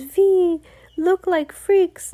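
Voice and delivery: put-on high, loud accented outcry; mock alarm. A narrator's reading. Another changing child in the witch's song, loud high mock alarm.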